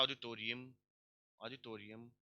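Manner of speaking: ऑडिटोरियम with stress on "tor" and a weak "yim"; reduced unstressed ending. Shown as a demonstration. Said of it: "ऐसे पढ़ो इसको". (ऑडिटोरियम में "टो" पर ज़ोर, अंत हल्का)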